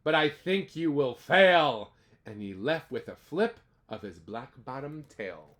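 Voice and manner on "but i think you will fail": loud, slow sneer. Acted. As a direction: drag out the taunt. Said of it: "sneered the bird".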